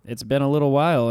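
ordinary delivery

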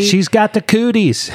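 high, slow playground taunt voice; childish taunt. Pretend. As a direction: tease like a kid at recess. Teasing about character's glowing hands.

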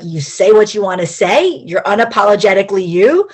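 ordinary talk, nothing marked

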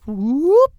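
high, loud whoop noise; a zany cartoon whoop; imitating an edited sound effect. Shown as a demonstration. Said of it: "I'm really bad at making sound noises".

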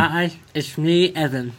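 slow, talking around a sore tongue. Pretend. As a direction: speak like your tongue hurts. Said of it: "Wait, just talk normal".

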